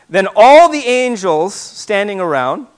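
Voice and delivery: ordinary delivery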